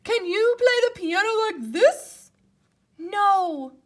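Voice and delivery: high loud boastful Toad voice; character voices. Acted. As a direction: show off as Toad.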